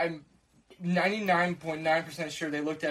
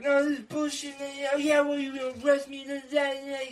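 mock drunk arguing; 9 semitones higher than usual. Adults arguing, yelling.

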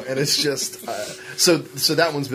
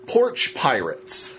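porch pirates, drawn out slowly; slow and deliberate; much slower than usual. Real, not acted. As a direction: spell out each word with heavy deliberation. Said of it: "I need to say it slowly".

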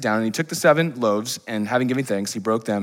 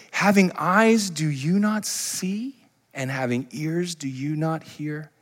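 slow rebuke; 5 semitones higher, much slower than usual. Retold or imitated. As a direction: draw out each question like a gentle rebuke. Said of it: "he says this".

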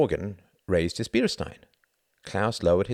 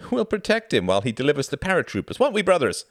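quick, high, jovial rally; rally the group in a quick, raised voice; laughing banter; 6 semitones higher, 3 dB louder, faster than usual. A narrator's reading. Man joking to his fellow pilots, jovial. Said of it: "laughed the man".

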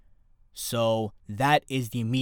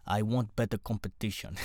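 badly faked Russian accent, softer; quiet, thick attempt at a Russian accent; Khabib; 3 semitones lower, 6 dB quieter than usual. Fighter stating his demand, imitated in bad Russian accent. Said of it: "my Russian accent is terrible".

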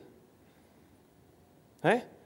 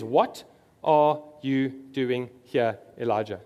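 slow, drawn-out question; 4 semitones lower, slower than usual. Acted. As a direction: stretch every word, very slowly. Repeats God's question, drawn out.

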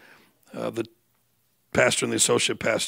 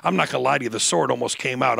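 usual speaking voice

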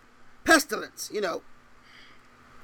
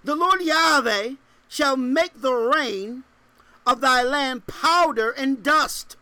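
solemn scripture reading; 3 semitones higher, 5 dB louder, slower than usual. Acted.

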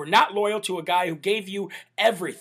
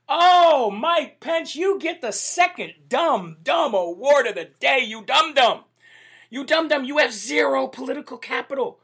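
higher-pitched derisive scolding; mocking outburst; 5 semitones higher than usual. Real, not acted. Host hands Mike Pence a mock award, derisive outburst. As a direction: exclaim it high and scornful, a mock award presentation.

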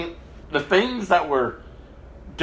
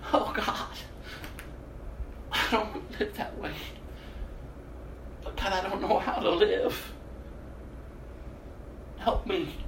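soft, half-whispered broken plea; retold crying prayer; 10 dB quieter than usual. Alone on the floor, begging God, softly crying. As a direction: pray as if collapsed on the floor in tears. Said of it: "threw myself on the ground crying".

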